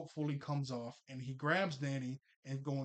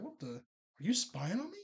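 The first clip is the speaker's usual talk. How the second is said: surprised accusation; 6 semitones higher than usual. Retold or imitated. Jazz catches her brother.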